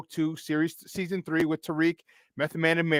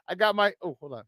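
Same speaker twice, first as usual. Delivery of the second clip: normal talking voice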